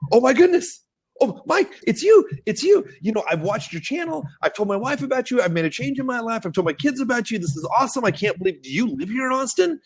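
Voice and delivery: high-pitched mock gushing of a thrilled fan; starstruck fan. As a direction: imitate an excited fan meeting an idol. Retelling a starstruck fan's greeting.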